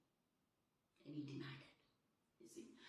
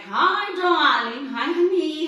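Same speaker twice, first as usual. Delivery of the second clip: much louder, sugary darling calls; mock sweet greeting; 5 semitones higher, 12 dB louder, slower than usual. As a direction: sing out the pet names loudly. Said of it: "I was like".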